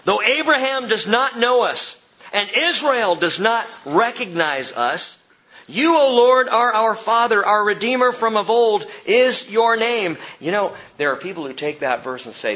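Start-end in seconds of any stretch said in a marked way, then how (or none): 0.0-10.2 impassioned, raised scripture cry; passionate pleading. Acted. Preacher reads Isaiah's prayer to the Father, passionate pleading. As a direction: plead it loudly with pathos, stretching key words. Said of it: "he pleads with this kind of intensity and pathos and passion".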